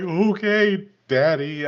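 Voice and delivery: lightly voiced nervous NPC; awkward NPC voice. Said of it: "he was like".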